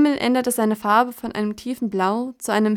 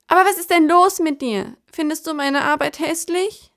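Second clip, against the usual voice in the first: beinahe weinerlich; 6 semitones higher, 3 dB louder than usual. Acted. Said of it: "mit leiser, beinahe weinerlicher Stimme".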